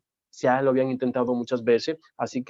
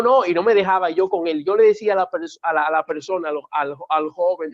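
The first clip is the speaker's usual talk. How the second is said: ordinary talk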